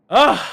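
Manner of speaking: loud, high exasperated ugh; annoyed groan. Real, not acted. Fed up after the burnt foil, loud annoyed groan. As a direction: let out a real fed-up groan.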